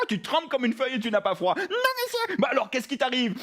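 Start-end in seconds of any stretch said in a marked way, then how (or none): 1.6-2.4 high little puppet voice; frightened puppet. Acted. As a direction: answer as a timid, trembling puppet. Trembling puppet answers Philippe.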